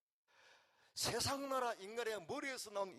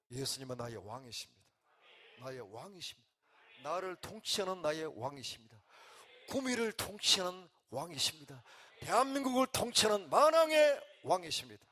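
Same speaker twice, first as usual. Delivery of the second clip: leading the congregation's confession, building to a shout; 2 semitones lower, 9 dB louder than usual. Shown as a demonstration. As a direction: build each line until you shout the last. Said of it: "입술로 고백해야 돼요".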